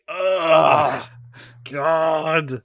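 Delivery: mock fed-up sigh; annoyed groan. Roberto fed up with the no-smoking rule, hammed-up groan. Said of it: "and he's like".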